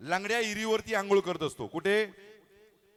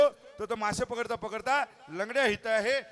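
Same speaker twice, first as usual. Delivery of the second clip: usual speaking voice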